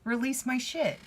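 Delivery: high, bright-eyed and eager, with a laugh breaking in; excited high voice. Pretend. To a tarot client, mimicking a relieved future self, high and eager. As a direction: squeal it like a kid who can't wait. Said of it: "you get to a point where you're like".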